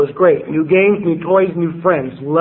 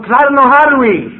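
retold blurted admission; 7 semitones higher, 5 dB louder than usual. Admits he cannot read.

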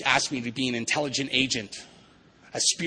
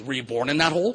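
mock probing question, louder with a rising end; psychiatrist; 3 semitones higher, 6 dB louder than usual. Psychiatrist questions the man in the hole, louder mock probing. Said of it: "And the psychiatrist says".

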